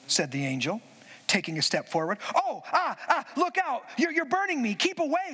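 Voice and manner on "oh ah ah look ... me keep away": higher, frightened yelps of pain; a scared ghost backing off in alarm; panicked ghost. Acted. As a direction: recoil from the burning angel. Ghost burned, retreats from angel.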